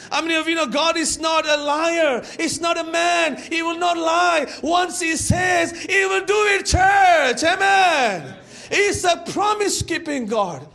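loud, high preacher's proclamation; preaching at full force. Real, not acted. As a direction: build to a drawn-out shout to the congregation.

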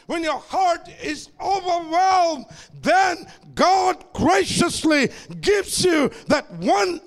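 rising, shouted sermon climax; preaching at full force. Acted. Preacher rallying the congregation, full-force preaching.

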